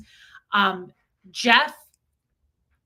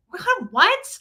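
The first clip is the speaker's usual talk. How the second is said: high, rising shocked "What?"; disbelief, pitched way up; wife startled by husband's sudden exit, surprised; 11 semitones higher, 4 dB louder than usual. Retold or imitated.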